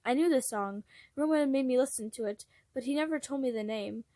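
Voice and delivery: ordinary delivery, nothing marked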